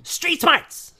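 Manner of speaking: loud, very high comic cry; high-pitched quoted punchline; mock shout. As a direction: yell it like a stand-up catchphrase. Quoting a stand-up comedy bit.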